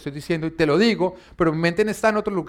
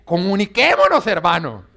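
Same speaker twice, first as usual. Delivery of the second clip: exasperated parent; 3 semitones higher, 8 dB louder than usual. Retold or imitated. Exasperated parent pleading with teen.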